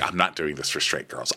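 mild mock grumble; upset mutter. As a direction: grumble it under your breath. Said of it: "he's all upset".

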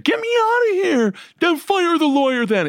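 high-pitched spoiled-brat imitation; mock whiny rich kid. Inmate mimics rich kid phoning his dad, mock pleading. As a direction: plead shrilly like a pampered son, mockingly. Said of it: "kept on calling his dad".

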